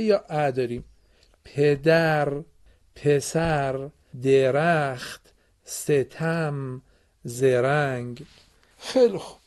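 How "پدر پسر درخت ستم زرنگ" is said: each word spelled out very slowly; teacher modelling the row slowly for the children; slow model reading. Shown as a demonstration. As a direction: read the words drawn out for the pupils.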